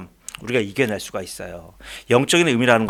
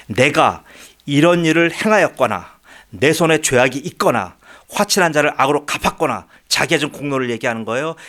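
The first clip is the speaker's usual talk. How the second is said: normal talking voice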